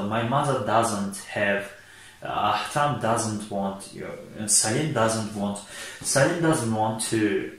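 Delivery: Salim doesn't want, drawn out word by word; slow and deliberate. Shown as a demonstration. Teacher modelling doesn't for third person, very slow. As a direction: spell the phrase out slowly for learners. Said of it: "шахси сеюм бошад".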